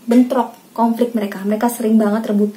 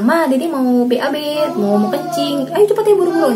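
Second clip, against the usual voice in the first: put-on urgent child; 5 semitones higher than usual. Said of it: "mereka langsung ke kamar mandi bilang".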